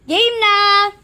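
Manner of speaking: loud shout to start; calling out. Real, not acted. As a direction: yell it to the whole house. (malakas na sigaw)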